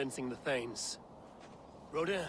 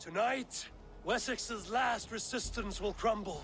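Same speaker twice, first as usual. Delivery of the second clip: higher, ringing call to arms; battle declaration; 7 semitones higher than usual. Acted. Eivor tells the army Wessex will fall, proclaiming. War leader before the battle.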